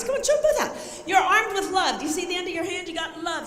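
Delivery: usual speaking voice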